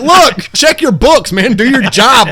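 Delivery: high, loud and slow; mock frustrated recruit; exasperated outburst. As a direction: plead with rising exasperation. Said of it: "And I was like".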